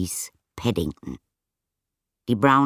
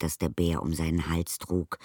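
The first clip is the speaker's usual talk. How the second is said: usual speaking voice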